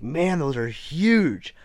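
retold slow-motion amazement; amazed. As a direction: stretch it out, imitating an amazed friend. Retelling friend's amazement at wolf tracks.

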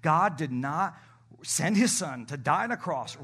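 ordinary delivery

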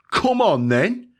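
loud, high challenge, come on then; English fight taunt. Retold or imitated. A bloke squaring up to a stranger, loud and high-pitched taunt. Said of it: "the English preferred way of starting a fight".

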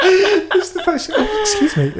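high, helpless laughing speech; laughing hard. Real, not acted. Cohost cracking up over the TikTok clip, laughing helplessly. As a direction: squeeze the words out through real laughter.

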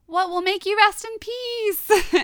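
high, slow put-on girl voice; mock pleading girl. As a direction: plead with the ghost, hammed up. Retold girl pleading with ghost.

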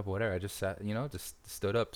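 ordinary talk

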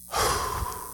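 long breathy exhale; child's calming sigh. Retold or imitated. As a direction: imitate a child letting it all out. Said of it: "and he just goes".

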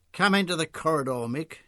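toned-down Australian woman's voice; mildly strident Australian. A narrator's reading. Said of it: "said less stridently".

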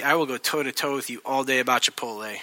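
normal talking voice

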